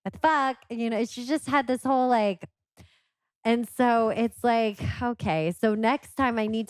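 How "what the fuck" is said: put-on higher childlike what the fuck; mock little girl. Mom imitating her daughter swearing again, higher. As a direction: squeak the curse back. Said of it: "and she's like".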